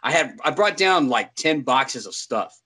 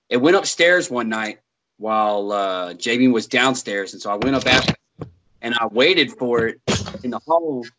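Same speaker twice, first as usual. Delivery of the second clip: ordinary talk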